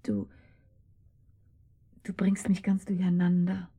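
soft, confused whisper. Acted. Woman whispering to her lover that he confuses her. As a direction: whisper it, flustered and close.

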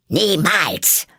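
furious high cry; angry. A narrator's reading. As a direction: snap it back in fury. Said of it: "rief die Königin wütend".

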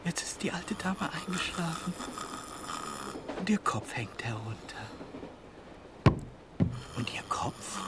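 breathy hushed whisper; soft unvoiced whisper. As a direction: whisper it like a ghost story. Eerie narration of sleeping dead.

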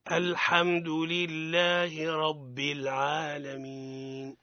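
slow chanted tilawa; Quran recitation. Acted. Teacher reciting the verse for students to repeat, slow chant. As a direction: intone each word slowly, like prayer.

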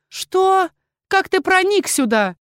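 high, startled question; shocked high pitch; astonished. A narrator's reading. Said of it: "не менее изумлённая".

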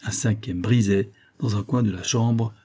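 usual speaking voice

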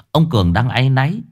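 ordinary delivery

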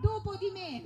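normal talking voice

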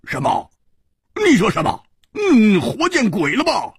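high-pitched, incredulous anger; indignant outburst, pitch leaping up; angry. A narrator's reading. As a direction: burst out in outraged disbelief. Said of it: "胡铁花怒道".

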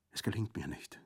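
breathy, faint whisper; wounded man admitting defeat in a whisper; weak whisper. Acted. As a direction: barely get the words out. Wounded Orso fails to stand.

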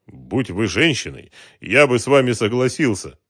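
ordinary delivery, nothing marked

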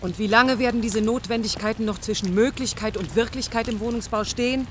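indignant demand. Acted. Heated argument with the boss.

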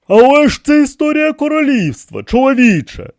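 high, loud remonstrance; protesting. A narrator's reading. King protests about the kingdom's history, insistent.

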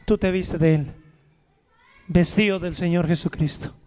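loud, low, fervent preacher voice; preaching. Acted. Pastor preaching to the congregation, fervent and loud. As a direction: preach it out with force.

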